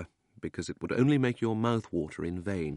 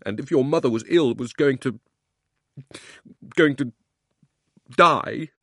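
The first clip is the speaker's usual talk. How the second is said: put-on loud, higher, building boyish tirade; wound-up outburst; 5 semitones higher, 7 dB louder than usual. Boy reveals his mother's illness, loud distraught outburst. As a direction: rail on until the words stall near tears. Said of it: "boy was too wound up".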